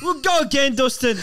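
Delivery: mock rallying cry. Acted rallying quote.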